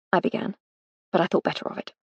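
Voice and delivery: ordinary talk, nothing marked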